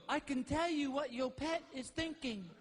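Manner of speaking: put-on psychic voice; pet psychic. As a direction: announce it to camera as a pet psychic. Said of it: "look in the camera and go".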